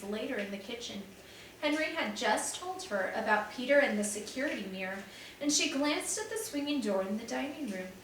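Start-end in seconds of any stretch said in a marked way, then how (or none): none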